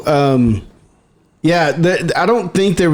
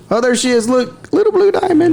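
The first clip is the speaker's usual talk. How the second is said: cooing at a pet; 10 semitones higher than usual. Real, not acted. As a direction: coo it like talking to a pet. Cooing at the cat.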